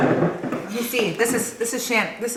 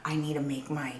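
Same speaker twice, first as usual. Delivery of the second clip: whispering; 6 semitones lower, 13 dB quieter than usual. Pretend. Voicing Noella's excuses.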